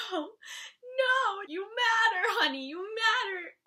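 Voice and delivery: high, loud comforting plea near tears; tearful reassurance. Retold or imitated. Fan consoling Dean who says he doesn't matter, tearful. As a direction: comfort him through tears. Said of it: "I was just like".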